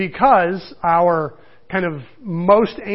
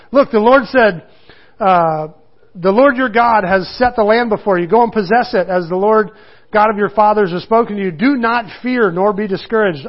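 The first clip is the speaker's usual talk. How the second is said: a slightly raised, earnest Bible reading; scripture reading; 2 semitones higher, 4 dB louder than usual. Acted. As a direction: read it out to the congregation. Reads scripture aloud.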